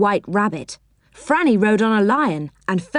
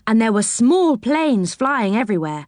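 ordinary delivery

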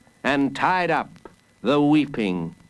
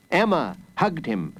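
plain reading voice

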